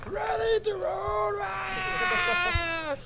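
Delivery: very high, slow, stretched-out battle cry; hammed-up hype yell of a trainer bursting in; mock explosive shout. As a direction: burst into the room bellowing, way up high. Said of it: "exploded in the room like".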